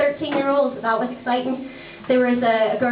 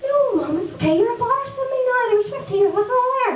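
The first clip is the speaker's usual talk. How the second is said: put-on much higher, cheerful boy voice; high mock son; son's voice; 12 semitones higher than usual. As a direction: pipe up like a proud kid.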